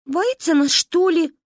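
belligerent. A narrator's reading. Manka belligerently asks if the girl is scared. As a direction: sniff it out scornfully. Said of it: "воинственно шмыгнула носом Манька".

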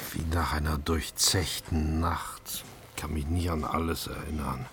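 barely able to speak after waking; weak whisper. Real, not acted. Patient waking in hospital whispering to his rescuer.

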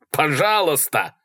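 yelled plea. A narrator's reading. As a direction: stretch the plea into a yell. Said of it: "заорал Малькольм". (протяжная крикливая мольба)

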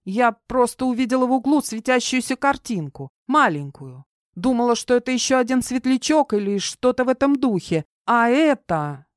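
put-on high, anxious girl's voice; nervous young woman making excuses; flustered girl. A narrator's reading. Voices Kara explaining herself.

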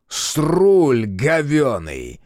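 loud, very drawn-out bark. A narrator's reading. Long-eared captive naming his servant, barked. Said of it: "рявкнул длинноухий".

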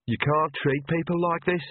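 put-on mildly sulky young woman; resentful, grudging complaint; sullen. A narrator's reading. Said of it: "said Agnes sullenly".

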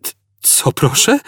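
high, stammering disbelief; stammered shock. A narrator's reading. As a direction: choke on the question. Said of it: "wyjąkał".

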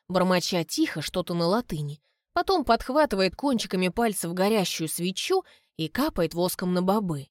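usual speaking voice

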